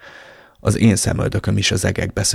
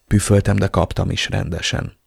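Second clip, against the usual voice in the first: usual speaking voice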